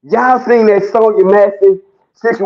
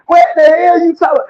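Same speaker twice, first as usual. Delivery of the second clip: mock outraged shout; 9 semitones higher than usual. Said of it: "That bitch said".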